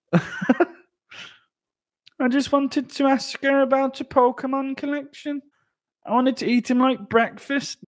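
panicked high-pitched impression; Jake Hadley. Fighter being stung, high panicked excuse. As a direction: protest innocence in a very high voice. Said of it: "Impression of Jake Hadley being stung by".

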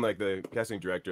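normal talking voice

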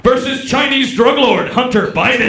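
monster truck promo, all caps; wrestling announcer. Acted. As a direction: Trump campaign email read as a wrestling hype promo.

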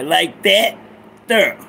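drawn-out "that", then "thurr" for "there"; "that there" as slang. Shown as a demonstration. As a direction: slowly demonstrate "that", pause, "there" as "thurr". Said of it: "You gotta say it like that there".

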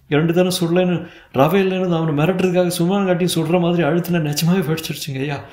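high-pitched desperate pleading; frantic, weeping appeal for mercy; tearful wailing. Acted. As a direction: beg for your life, near tears. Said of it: "அழுகை, ஓலம்".

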